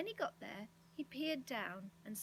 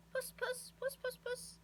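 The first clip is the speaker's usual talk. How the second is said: high-pitched cat call; 14 semitones higher than usual. A narrator's reading. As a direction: call puss in a high little voice. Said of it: "he called".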